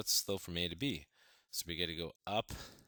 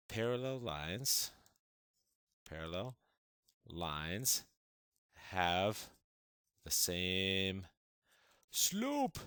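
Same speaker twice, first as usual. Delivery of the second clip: each word spaced out with long pauses; slow dictation; much slower than usual. Shown as a demonstration. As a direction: dictate the rule so students can write it. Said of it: "dictates rule word by word".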